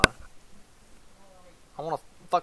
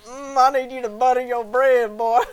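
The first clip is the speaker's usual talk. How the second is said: loud, high hammed-up Southern accent; mock Southern blowhard; 8 semitones higher, 8 dB louder than usual.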